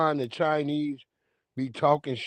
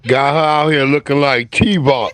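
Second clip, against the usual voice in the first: louder mocking punchline; loud roast; 9 dB louder than usual. Real, not acted. Streamer roasting the woman's eyebrows, loud and mocking. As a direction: bellow the joke at her.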